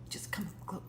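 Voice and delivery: hushed, breaking-off whisper; pleading whisper. Acted. Ex-lover asks Peter to come closer, pleading whisper. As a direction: beg him closer under your breath.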